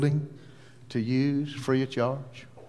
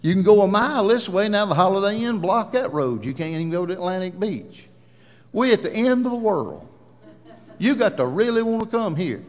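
high, animated retelling with swooping pitch; comic exasperation; 6 semitones higher, faster than usual. Retold or imitated. Preacher telling Ann how remote the church is, comically exasperated.

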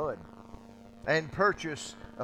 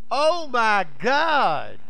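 loud, drawn-out mock exclamation; stretched, high-pitched mock OMG; children's exclamation; 5 semitones higher, 6 dB louder, much slower than usual. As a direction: imitate a child's overblown outburst, high and slow. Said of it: "I hear little children".

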